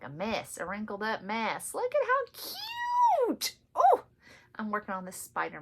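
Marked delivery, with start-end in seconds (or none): 1.7-4.0 squeaky high squeal, much higher, slowed; cute squeal. Real, not acted. Stitcher squealing at her cute spider motif, high squeal. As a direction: coo over the stitching like a puppy.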